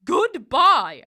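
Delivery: loud, high parting shout; angry goodbye. Acted. Goblin leaving Herschel, loud shouted goodbye. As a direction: fling the goodbye out as he flies.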